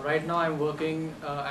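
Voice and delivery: usual speaking voice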